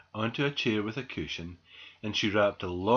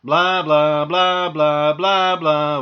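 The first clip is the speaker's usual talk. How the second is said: loud two-beat siren imitation; ambulance siren; 5 semitones higher, 13 dB louder, much slower than usual. A narrator's reading. Ambulance rushes squirrel to hospital, blaring siren. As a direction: blare it like a siren. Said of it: "went the ambulance".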